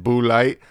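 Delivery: "Bud Light" with the D dropped, "boo-light"; Boo Light pronunciation. Retold or imitated. Host quoting the ad's funniest character, "Bud Light" as "Boo Light". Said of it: "the guy that says it".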